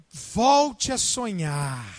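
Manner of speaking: each word of "Volte a sonhar" said slowly and firmly; slow, firm line to spouse. Shown as a demonstration. Pastor gives couples a line to tell each other, slow and firm. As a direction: speak it like a vow. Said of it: "e fala assim".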